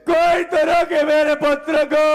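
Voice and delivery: loud desperate appeal; a grieving father crying out to everyone; anguished cry. Acted. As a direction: call out for someone to stop him. Father cries out in grief.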